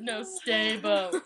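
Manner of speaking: ordinary talk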